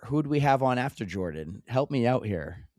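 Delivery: usual speaking voice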